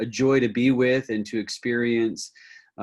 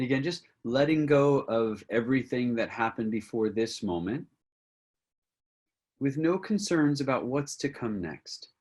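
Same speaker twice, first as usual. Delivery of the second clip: guided centering; 2 semitones higher than usual. Acted. Teacher leading a group with eyes closed, guided meditation. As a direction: gently guide them inward, phrase by unhurried phrase.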